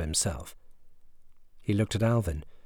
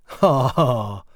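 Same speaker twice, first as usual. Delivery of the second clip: loud, jeering ha ha; boy laughs at his stepbrother; mocking laugh; 3 semitones higher, 7 dB louder than usual. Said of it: "Joshua laughed".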